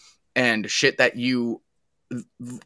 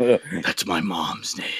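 lightly voiced Batman; 6 semitones lower, 5 dB quieter, much slower than usual. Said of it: "He's like".

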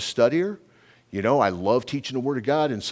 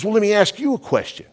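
high, loud retold challenge; frustrated, mad; 11 semitones higher, 5 dB louder than usual. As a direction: imitate a frustrated kid firing back, pitched way up. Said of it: "he got so frustrated".

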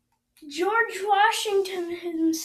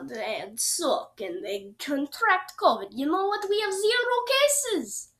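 bad Russian accent. Podcaster mocking Americans from a Russian perspective, bad Russian accent. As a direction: put on a badly faked Russian accent. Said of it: "that was my really bad Russian accent".

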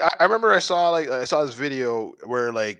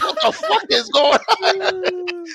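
much higher, slow squeal with a laugh; laughing disbelief; slower than usual. Retold or imitated. Father still holding his kid's hand, laughing high disbelief. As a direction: stretch it out in laughing shock. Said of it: "and I'm like".

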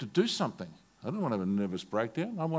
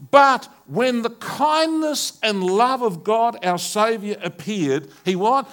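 loud, high, slow fervent preaching; full-force preaching; 8 semitones higher, 11 dB louder, slower than usual. Acted. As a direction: thunder out the scripture to the church.

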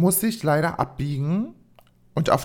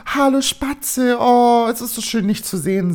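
put-on high, loud, gushing greeting to her child; überschwängliche Mutter; 7 semitones higher, 4 dB louder than usual. Voices mother greeting her child. (überschwänglich begrüßende Mutterstimme)

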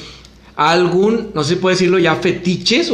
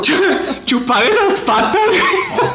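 frat bro; 11 semitones higher than usual. Real, not acted. Laughing bro banter.

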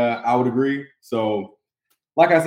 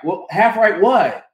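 slow, loud, higher barked challenge; mock drill sergeant; 8 semitones higher, 8 dB louder, slower than usual. Mocking drill sergeant command.

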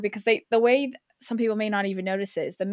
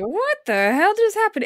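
mock bewildered; 11 semitones higher, 5 dB louder than usual. Dazed Monica's reaction voiced.

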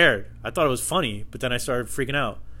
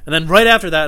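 ordinary delivery

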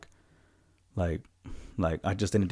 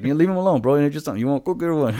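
higher, louder, fast mock scolding; mock scolding the ghost; 8 semitones higher, 8 dB louder, faster than usual. Telling the shadow people to back off, mock scolding. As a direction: shoo the ghost off jokingly.

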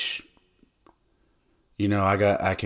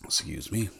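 just swallowed some tea; quiet after a sip of tea; while sipping a drink; 3 semitones higher, 6 dB quieter than usual. Real, not acted. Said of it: "I'm drinking a little tea right now".

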